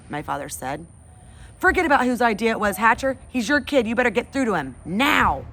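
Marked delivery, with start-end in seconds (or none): 1.6-5.4 angry boss. Acted. Vincent angrily demands from father.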